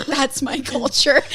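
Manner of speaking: mock offended Zara. Joking about a singer scolding a friend, slow mock-indignant voice. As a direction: drawl it out like a mock-offended pop star. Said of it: "[name] comes for you".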